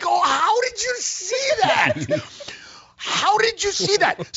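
high, slow, over-the-top astonished gasp; mock amazement. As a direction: pretend to be stunned by his genius. Mock amazement at friend's theory.

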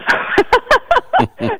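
a sudden delighted laugh; bright burst of laughter. Real, not acted. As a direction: laugh out at your own joke. Laughs at own nose demo.

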